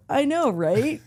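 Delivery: cocky reply. Retold or imitated.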